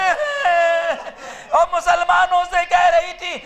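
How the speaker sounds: full-force preaching. Acted. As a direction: wail it out to the mourners. Sermon rises into lament. (پرجوش گریہ آمیز خطابت)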